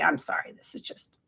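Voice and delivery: soft whispered sorry; whispered apology. Retold or imitated. Apologizing to everybody at the retreat, whispered. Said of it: "And I was like".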